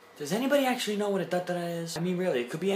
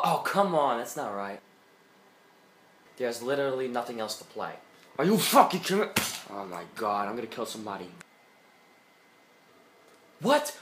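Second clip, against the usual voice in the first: usual speaking voice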